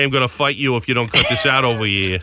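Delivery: put-on tough Boston accent; Boston guy in a boxing movie. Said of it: "the Boston accent".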